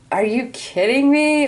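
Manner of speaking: high, half-laughing mock disbelief; a playful, exaggerated are you kidding me; incredulous. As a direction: toss it up high as if you can't believe it. Imagined reaction to someone's news.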